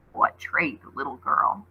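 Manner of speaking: low creeping beast voice; hoarse deep voice. Acted. Wolf creature questioning the girl, hoarse and deep. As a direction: creep it out between teeth. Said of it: "a hoarse, deep voice crept out".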